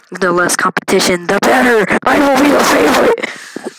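mock villain voice, building; scheming little brother. Little brother aiming at his sibling, mock villain voice. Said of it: "he's like".

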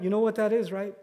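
ordinary delivery

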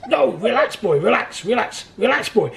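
high, loud imitation of a taskmaster; mock insistent urging. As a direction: press the point over and over, hammed up. Mimics Keb insisting during painful treatment.

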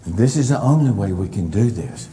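normal talking voice

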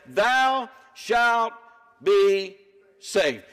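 preacher crowning the verse; proclaimed climax. Acted. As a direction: build to the word saved. Climax of scripture promise.

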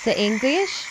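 put-on children's teacher calling out words; teacher vocabulary drill. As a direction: announce each animal name to little kids.

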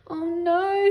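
high, loud, drawn-out wail. A narrator's reading. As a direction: wail it in dismay. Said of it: "she wailed".

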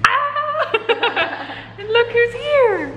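high, laughing delighted squeal; excited squeal. Real, not acted. Excited greeting to a child.

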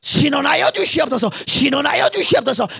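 loud, high pleading prayer; fervent prayer. Acted. Preacher crying out his prayer for vindication, loud. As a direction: beg God for vindication at full force.

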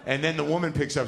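ordinary talk

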